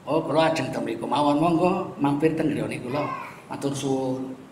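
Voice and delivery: soft, low Javanese-accented reply. Shown as a demonstration. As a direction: answer warmly in a Javanese lilt. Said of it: "Itu mesti logat Jawa".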